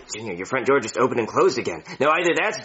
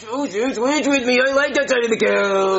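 higher, louder whining ask; whiny plea; 10 semitones higher, 3 dB louder than usual. Acted. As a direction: whine like a spoilt kid. Whining over the couch seat.

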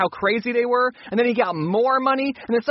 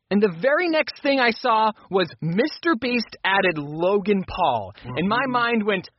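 usual speaking voice

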